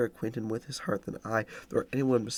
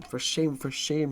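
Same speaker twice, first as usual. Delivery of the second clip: higher, indignant for shame; angry reproach; 5 semitones higher than usual. A narrator's reading. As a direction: scold her with indignation. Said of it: "she repeated angrily".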